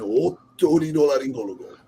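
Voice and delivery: Spanish word said with a Japanese accent; mock Japanese accent on a Spanish word. Shown as a demonstration. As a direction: say otorrinolaryngologo as if with a Japanese accent, syllables chopped and even. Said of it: "just say it in Japanese accent".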